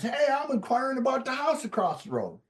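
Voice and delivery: high put-on voice for the biker; mock the big biker's polite inquiry; biker asking.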